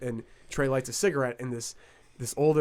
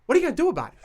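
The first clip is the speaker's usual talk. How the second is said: high-pitched mock tough guy; Todd Massey; 10 semitones higher, 4 dB louder, much faster than usual. Smoker daring the complaining man, mock-gangster defiance. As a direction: challenge him like a comic mob gangster. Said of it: "acts like he's a fucking mob gangster".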